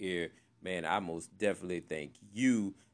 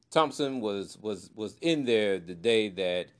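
ordinary talk, nothing marked

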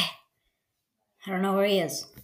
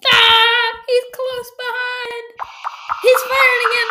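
play panic shouting; 12 semitones higher, 6 dB louder than usual. Pretend. Podcaster chased by gunman, high pretend-panic shout. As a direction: cry out as if chased.

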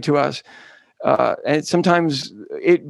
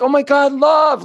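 high, loud breathless "oh my God"; gushing epiphany; 10 semitones higher, 4 dB louder than usual. Retold or imitated. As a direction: swoon like a sudden revelation. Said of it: "and you were like".

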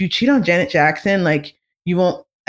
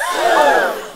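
sharp gasp. Pretend. Shocked reaction.

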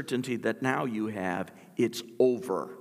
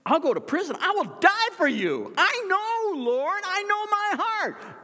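very high, loud, fervent vow; boastful Peter; 7 dB louder than usual. Acted. Peter vowing loyalty to Jesus, high loud fervent pledge. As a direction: swear it with all the bravado.

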